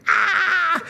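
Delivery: high laughing scream of a woman on a dark slide; retold scream. Wife screaming on a pitch-dark water slide, high laughing shriek. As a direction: shriek it the way his wife did, half laughing. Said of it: "Vicky started screaming".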